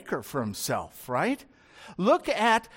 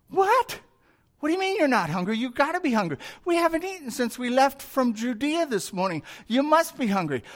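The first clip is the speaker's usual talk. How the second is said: higher, astonished What? then pressing on; disbelieving apostle, higher and insistent; incredulous disbelief; 5 semitones higher, faster than usual. Acted. Disciples can't believe Jesus isn't hungry, pitched higher in disbelief.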